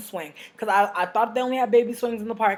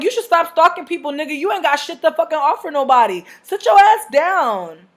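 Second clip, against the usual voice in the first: high, loud, scornful telling-off, slowing at the end; higher loud scolding that slows right down; loud roast; 8 semitones higher, 8 dB louder than usual. Retold or imitated. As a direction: tell him off, and drag out the last words.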